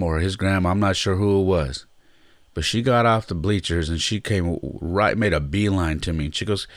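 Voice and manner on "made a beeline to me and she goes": usual speaking voice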